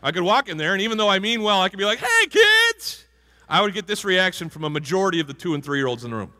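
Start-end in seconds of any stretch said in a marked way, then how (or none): 2.0-3.1 much higher, louder hammed-up booming greeting. Big man greets a room of toddlers, booming. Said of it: "with my booming voice".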